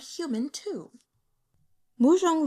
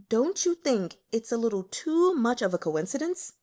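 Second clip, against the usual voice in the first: ordinary delivery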